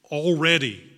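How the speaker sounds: urgent preacher's call, "already" loud and falling; stress on already. Real, not acted. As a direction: hit "already" hard and let it fall. Preacher stressing the ripe fields.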